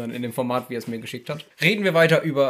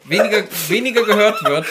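laughing loudly mid-sentence; words pushed out through laughter; 7 semitones higher, 10 dB louder than usual. Real, not acted. Laughs mid-sentence while complaining.

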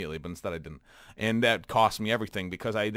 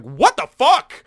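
high, loud, drawn-out shocked yell; 11 semitones higher, 10 dB louder, slower than usual. Retold or imitated. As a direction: blurt it out stunned and slow. Ambushed by a bird enemy.

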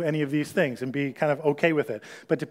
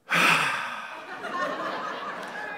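long, airy sigh; big sigh; 8 semitones higher, 5 dB quieter than usual. Acted. Preacher acting a partner who sighs for attention. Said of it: "all of a sudden you hear".

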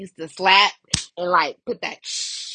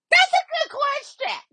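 excited shout; 3 dB louder than usual. Real, not acted. Excited reaction to quote.

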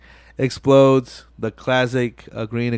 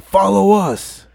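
high, loud, drawn-out order; acted command; 8 semitones higher, 5 dB louder, slower than usual. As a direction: call it out slowly like a gang order. Voicing biker ordering them along.

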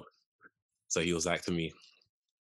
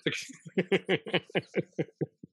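a high squeal of laughter; 10 semitones higher than usual. Real, not acted. Laughing at his friend's teasing, a high squeal. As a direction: squeal with laughter, caught off guard.

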